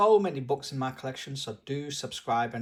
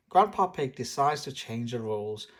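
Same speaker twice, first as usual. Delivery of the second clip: ordinary delivery, nothing marked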